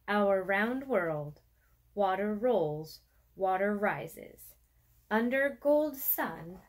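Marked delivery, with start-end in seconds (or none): none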